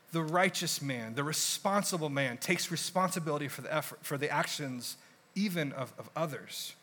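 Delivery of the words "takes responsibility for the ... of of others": ordinary talk, nothing marked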